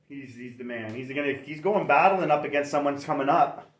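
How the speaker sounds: ordinary delivery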